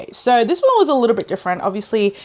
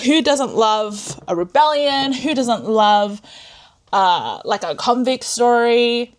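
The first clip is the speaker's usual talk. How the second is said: slow dramatic question; slower than usual. Pretend. Rhetorical musical hype.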